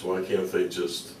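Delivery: ordinary talk, nothing marked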